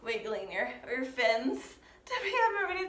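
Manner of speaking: usual speaking voice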